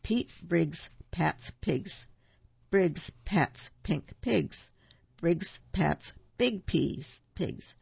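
slow, careful tongue twister. Shown as a demonstration. Host reading a tongue twister to children, slow and careful. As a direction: spell out each p slowly. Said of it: "here's a quick one".

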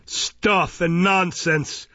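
dismissive rebuke from the bench; scornful magistrate. Acted. Magistrate scoffs at fainting boy.

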